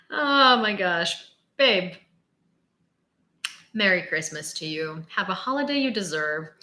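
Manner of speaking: ordinary talk, nothing marked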